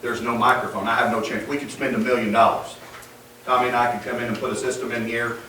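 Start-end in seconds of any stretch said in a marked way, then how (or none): none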